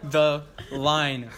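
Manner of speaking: slow, loud, drawn-out demand; menacing command. Acted. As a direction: threaten with each word. Threatening with katana.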